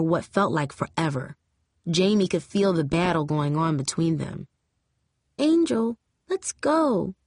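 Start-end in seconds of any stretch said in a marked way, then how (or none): 5.4-7.1 high, voiced little-girl plea, barely whispered; small high child voice tugging at big sister; little sister's whisper. A narrator's reading. Little sister urging Angel to leave, high mock-whispered plea. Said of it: "Dion whispered".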